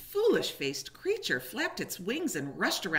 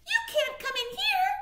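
tiny squeaky high mouse voice; little mouse; 4 dB louder than usual. A narrator's reading. Mouse guarding its home from a stranger, high squeak. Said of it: "said a little gray mouse".

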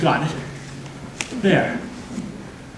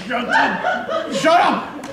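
very high-pitched outburst; irritated mock yell; 13 semitones higher, much slower than usual. Sleeper tells the wailer to shut up, irritated high yell. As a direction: shriek at whoever woke you. Woken sleeper yells at the mourner.

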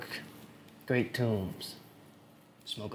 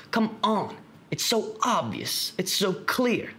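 high-pitched, pleading exasperation; exasperated; 9 semitones higher, slower than usual. Acted. Frustrated that Joey can't see through the cult, exasperated. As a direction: plead as if it's staring everyone in the face.

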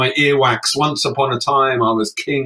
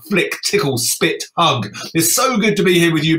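normal talking voice

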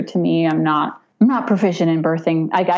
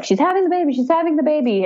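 excited husband; 10 semitones higher, much faster than usual. Retold or imitated. Husband texting everybody.

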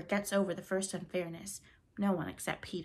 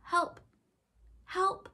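high, thin cry for help; feeble cry; 12 semitones higher, 3 dB louder than usual. A narrator's reading. Stranded boy crying for help, feeble and high. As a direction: call out weakly from the rock, frightened. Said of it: "a feeble cry".

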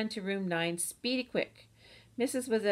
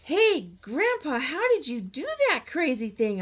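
loud, higher excited holler; 7 semitones higher, 7 dB louder than usual. Acted. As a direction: pop out and holler at grandpa. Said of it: "I hollered".

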